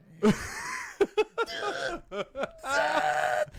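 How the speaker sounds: high, loud squealing mock laugh; imitated stifled laugh. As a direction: act out a laugh bursting through as you fight it. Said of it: "I'd do the, like".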